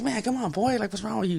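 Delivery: lightly voiced scolding father, pitched high; mock a dad's exasperated telling-off; a hint of a reproving dad, high-pitched; imitating his dad. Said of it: "my dad used to say that".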